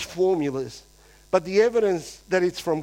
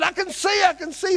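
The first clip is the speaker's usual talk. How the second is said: much higher, overjoyed healed man; excited shout; 11 semitones higher than usual. Retold or imitated. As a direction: yell it out, you can finally see. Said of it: "jumped up and down and said".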